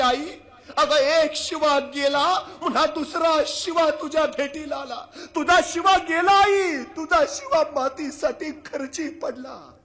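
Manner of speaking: slow, loud, high lament; grieving king's cry. Acted. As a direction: cry out the loss, drawing out each word. King announces Shiva's death. (राजाचा आक्रोश, हळू आणि मोठ्याने)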